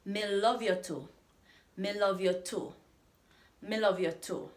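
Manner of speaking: "Me love you too" with the weight on "love"; teacher repeating "Me love you too" for learners; Jamaican patois demo. Shown as a demonstration.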